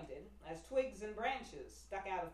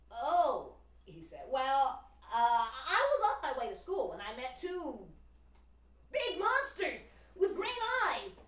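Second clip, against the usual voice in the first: loud, higher flustered storytelling; nervous fibbing; 6 semitones higher, 9 dB louder, slower than usual. Acted. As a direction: stammer out a tall tale. Pinocchio making up a tale.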